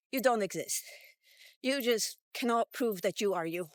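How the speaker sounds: normal talking voice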